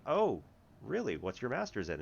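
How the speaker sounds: dad asking with mild surprise; surprised dad. Retold or imitated. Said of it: "And he's like".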